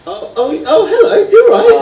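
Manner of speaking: high, laughing in disbelief; shocked laughter. Real, not acted. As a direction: caught off guard, laughing high. Prank revealed, interviewer shocked.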